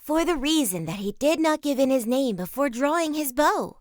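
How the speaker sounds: high, loud angry protest; a wronged yeoman crying foul; indignant outcry. A narrator's reading. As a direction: cry out against the cheat. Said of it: "cried Stuteley".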